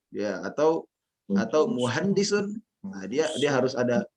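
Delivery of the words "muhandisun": "muhandisun" climbing in pitch to the end; "muhandisun" left high, like an item in a list; rising intonation. Shown as a demonstration. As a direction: lift the final syllable of "muhandisun". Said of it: "kenaikan intonasi".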